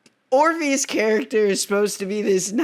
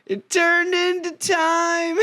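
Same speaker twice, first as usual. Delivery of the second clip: put-on high, slow lover-boy voice; drawn-out dreamy lover boy, breaking into laughter; naive Orpheus; 6 semitones higher, slower than usual. Retelling Orpheus's answer in a high, slow mock voice. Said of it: "And Orpheus is like".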